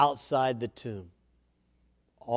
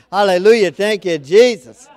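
loud praise; 4 semitones higher, 12 dB louder than usual. Real, not acted. Preacher resets after fumbling the verse.

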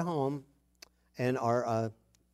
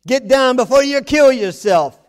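louder, higher warning shout; mock dad shout; 8 semitones higher, 11 dB louder than usual. Father warning a child who climbs, loud mock shout. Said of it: "to say the darndest things".

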